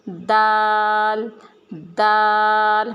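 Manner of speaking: دال with the tongue at the tooth roots, drawn out; slow clear دال; dal with tongue at roots. Shown as a demonstration. Teacher modelling دال slowly for the class. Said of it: "جڑوں میں ہم نے زبان کو لگانا ہے".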